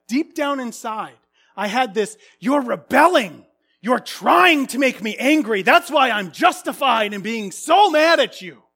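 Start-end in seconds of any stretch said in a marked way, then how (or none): 2.4-8.6 put-on loud, accusing voice toward the kids; inner accusation. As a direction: justify the anger, indignant.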